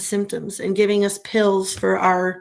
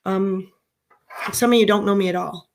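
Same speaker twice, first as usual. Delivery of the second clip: ordinary talk, nothing marked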